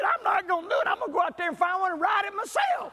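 husband shouting his refusal back to his wife; gleeful shout. Retold or imitated. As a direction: shout back like a kid who wants in.

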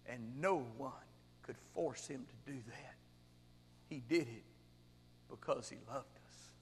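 low, soft, with long pauses; hushed solemn answer. Retold or imitated. Prisoner answers the angry violinist about God's love, hushed and solemn. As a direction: answer quietly, leaving space after each phrase. Preacher voices Corrie's gentle answer.

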